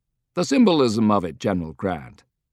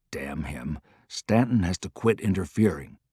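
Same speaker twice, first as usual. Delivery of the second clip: low, soft mutter under the breath; annoyed commander muttering low and soft; muttered softly; 3 semitones lower, 6 dB quieter than usual. Acted. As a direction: grumble it lower and softer to yourself. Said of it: "Grant muttered softly".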